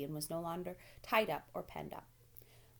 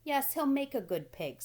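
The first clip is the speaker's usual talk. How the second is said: farmer; 7 semitones higher, 5 dB louder than usual. A narrator's reading. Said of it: "said Mister Zuckerman".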